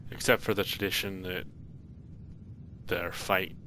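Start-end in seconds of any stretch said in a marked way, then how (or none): none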